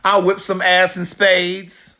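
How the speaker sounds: slow and loud with full sass; bragging. Shown as a demonstration. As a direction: drawl it out slow like a boastful gloat. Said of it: "What y'all know about spades?".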